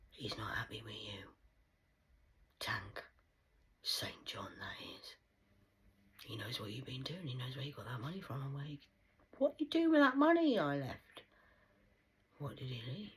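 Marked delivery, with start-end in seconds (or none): 0.1-8.4 low whispered warning; whispered threat to the viewer. Real, not acted. 9.4-11.2 voicing the dead. Voicing dead John, loud.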